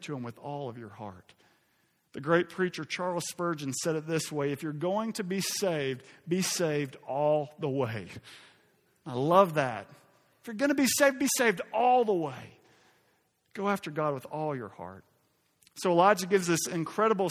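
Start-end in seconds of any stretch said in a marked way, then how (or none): 4.5-8.1 slow, deliberate quotation; measured quote. Retold or imitated. Slow first reading of quote. 10.4-12.6 higher, louder, impassioned exhortation; emphatic repeat. Retold or imitated. Emphatic repeat of loved quote.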